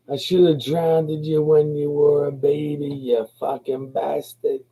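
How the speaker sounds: high, drawn-out Irish nana scolding; Irish brogue. Retold or imitated. Nana from Kerry scolding her grandchild, high Irish brogue. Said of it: "like, Irish brogue".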